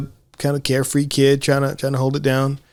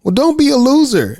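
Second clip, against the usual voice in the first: put-on pitched way up, sassy little girl; high bratty kid voice; 11 semitones higher, 3 dB louder than usual. Little girl teasing her new friend, high and sassy. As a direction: play the cheeky kid brushing off her friend. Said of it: "Mooney's like".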